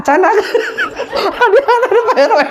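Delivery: high-pitched burst of laughter; preacher doubled over laughing at the crowd; cracking up. Real, not acted. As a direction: break into helpless laughter. Cracking up at audience.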